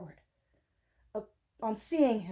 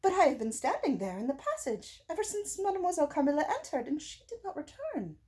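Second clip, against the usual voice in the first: higher, worried governess protesting; fretful governess, pitched up; anxious Madame; 7 semitones higher than usual. A narrator's reading. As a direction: insist she never left the passage, voice raised high.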